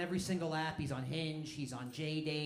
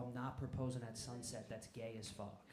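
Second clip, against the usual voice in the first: lowered voice, mock confidential; retold whisper; 4 semitones lower, 8 dB quieter than usual. Boyfriend sneaking up behind his girlfriend, half-whispered aside. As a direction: drop it softly as if into her ear. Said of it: "I whisper in her ear".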